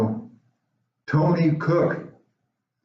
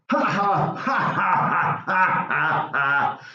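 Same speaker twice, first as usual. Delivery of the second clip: high, slow taunting ha ha ha; mocking bully laugh; 4 semitones higher, much slower than usual. Bully Tony laughs at George before slapping his sandwich. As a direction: laugh down at the little kid.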